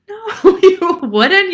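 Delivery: loud, high laughing denial. Real, not acted. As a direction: burst out laughing at the idea. Laughing answer to rhetorical question.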